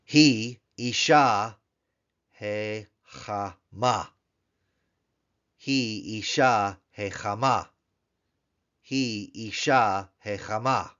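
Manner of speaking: teacher reads he Isha Hechama slowly, syllable by syllable; slow Hebrew model. Shown as a demonstration. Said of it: "If we wanted to say she is".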